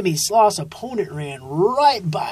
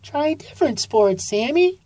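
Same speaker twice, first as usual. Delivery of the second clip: high, gentle mom voice; a raised, motherly storybook voice; high-pitched mom encouraging her son; mother sloth voice; 9 semitones higher than usual. A narrator's reading.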